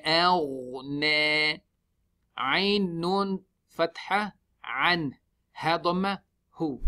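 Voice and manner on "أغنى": أغنى with ghain unsmiled, slow and drawn; ghain without smiling. Shown as a demonstration. Teacher demonstrating أغنى to students, slow careful recitation. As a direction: say أغنى slowly, lips neutral on the ghain. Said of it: "we don't smile on the ghain".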